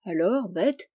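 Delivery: plain reading voice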